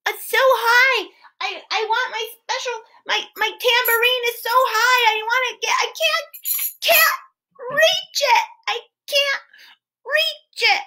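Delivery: put-on high, whining child voice; storyteller playing Andrea, who can't reach her tambourine; whiny little girl. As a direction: whimper that it's out of reach. Storyteller voicing the crying girl.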